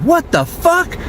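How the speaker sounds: high, squeaky mock-startled outburst; mock disbelief. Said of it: "I'm like".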